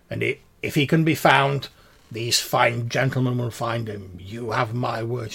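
stately gentleman, measured and confident; reassuring pompous gentleman. Acted. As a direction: pledge help with grand formality.

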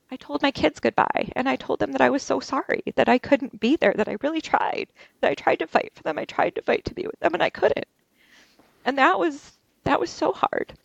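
real grief rising as she remembers saying goodbye; voice breaking. Real, not acted. Saying goodbye to her kids.

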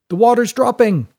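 high, loud shout of news; calling up. A narrator's reading. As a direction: call it up to those above. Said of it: "called up a voice from below".

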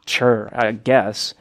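unimpressed mom. Retold or imitated. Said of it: "she's like".

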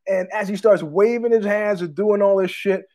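usual speaking voice

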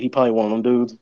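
usual speaking voice

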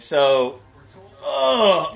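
ordinary delivery

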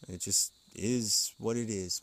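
ordinary talk, nothing marked

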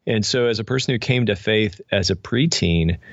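ordinary talk, nothing marked

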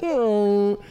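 high held hum imitating mic feedback. Describing the mic noise when he got punched. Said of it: "that feedback noise".

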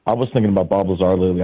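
ordinary talk, nothing marked

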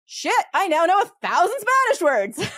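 high, loud burst of delighted surprise; excited realization. Retold or imitated. Recalling her cognate discovery, a high excited exclamation. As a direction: blurt it out like you just struck gold. Realizing she knows many words.

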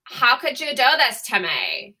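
hammed-up imitation of Anna Delvey; Anna Delvey accent. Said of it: "I can't stop doing the accent".

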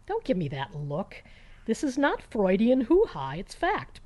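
ordinary delivery, nothing marked

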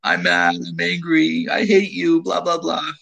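high, childish mock tantrum voice; angry kid. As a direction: lightly play a cross little boy whining. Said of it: "he says to me".

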